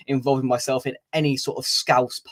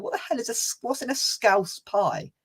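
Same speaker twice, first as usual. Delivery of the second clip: baffled disbelief; 9 semitones higher, 4 dB quieter than usual. Retold or imitated. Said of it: "I turned around and went".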